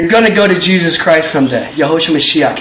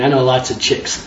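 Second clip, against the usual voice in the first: confiding joke; 8 semitones lower, 6 dB quieter than usual. Acted. Jesus joking confidentially.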